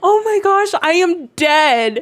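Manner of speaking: loud, high laughing exclamation, slow; dying of laughter. Real, not acted. Reacting to Lex getting her Beardo, real laughter. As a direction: gasp it out while cracking up. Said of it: "I'm crying".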